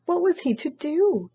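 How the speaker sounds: usual speaking voice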